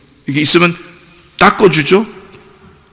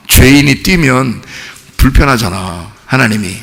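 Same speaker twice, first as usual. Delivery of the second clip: normal talking voice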